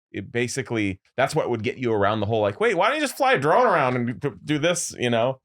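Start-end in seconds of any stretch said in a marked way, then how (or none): 2.6-4.9 high, puzzled viewer voice; mock skeptic. A friend mocking a skeptical viewer, high and puzzled. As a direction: play the doubting viewer asking why not a drone.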